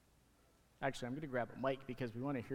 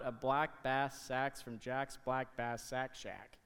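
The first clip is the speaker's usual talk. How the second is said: slowly reading out a tongue twister, each word placed; slow careful tongue twister; 3 semitones lower, much slower than usual. Shown as a demonstration. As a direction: spell it out slowly for the kids. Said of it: "Here's another one".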